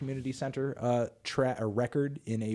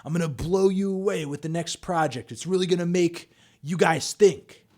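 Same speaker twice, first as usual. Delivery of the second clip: lightly imitated, sure of himself; a hint of a cocky promise; 6 semitones higher, 4 dB louder than usual. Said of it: "This is him talking about Good Kid".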